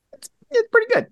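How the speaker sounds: squeaky high-pitched verdict; mock high rating. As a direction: say it in a silly high voice.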